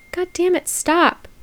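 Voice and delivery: high, falling god damn it; exasperated groan. Real, not acted. Reacting to the thirty-year-old's message, high exasperated groan. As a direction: squeal it out in despair.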